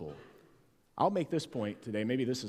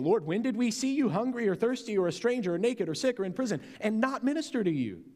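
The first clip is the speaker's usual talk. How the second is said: pleading questioners; 9 semitones higher, 4 dB louder than usual. Acted. The condemned answer the king.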